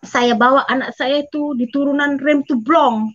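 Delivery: usual speaking voice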